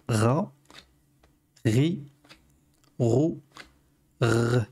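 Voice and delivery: "Ra, Ri, Ro, R", each set apart by pauses; syllable drill. Shown as a demonstration. Teacher recaps the letter's vowels, spaced "Ra, Ri, Ro, R". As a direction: model "Ra, Ri, Ro, R" one by one. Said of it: "on va voir au niveau de la prononciation".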